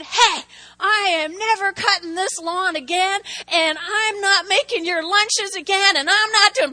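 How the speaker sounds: loud, high-pitched re-enacted tirade; angry eruption. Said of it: "And I erupted".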